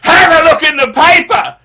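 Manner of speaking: loud, high-pitched shout; dad shouting. Retold or imitated. Son imitates father's advice, loud shouting. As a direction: yell it as a gruff order. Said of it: "He goes".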